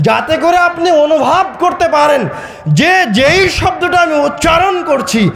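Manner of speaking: impassioned sermon, slow stretched key words; forceful preaching. Real, not acted. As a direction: press the point with mounting insistence.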